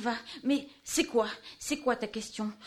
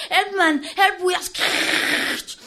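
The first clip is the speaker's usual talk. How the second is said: much higher and louder, drawn-out yell; desperate cry for help; 7 semitones higher, 11 dB louder, much slower than usual. Acted. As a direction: scream for help as the threat arrives.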